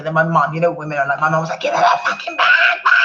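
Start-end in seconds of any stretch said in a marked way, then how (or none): 1.6-2.9 high, loud shriek at the attackers; imitating mum's shout. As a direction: yell it like a furious mum, laughing through it. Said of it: "Like this".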